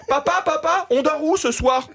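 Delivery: put-on high-pitched child calling Dad; little kid. Kid to his father on the plane, high eager child voice. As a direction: play a small boy asking his father. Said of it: "le gamin".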